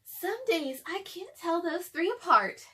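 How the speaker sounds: a fond, amused mum; mother's voice. Acted.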